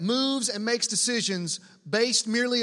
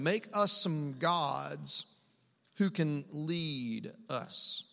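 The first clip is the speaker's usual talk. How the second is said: lower, drawn-out words; slow, deliberate quote; 5 semitones lower, 4 dB quieter, much slower than usual. Acted. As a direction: repeat it slowly, low, letting each word land. Repeats the demand in disbelief.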